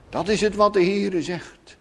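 normal talking voice

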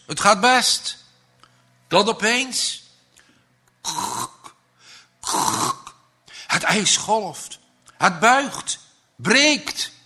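building tension. A narrator's reading. Ice breaking, rising tension.

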